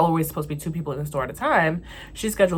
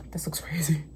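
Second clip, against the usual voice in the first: breathy whisper to herself; whispered aside; 6 dB quieter than usual. Real, not acted. Muttering to herself mid rant, whispering. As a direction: let it slip out under your breath. Aside while ranting to camera.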